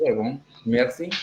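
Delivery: usual speaking voice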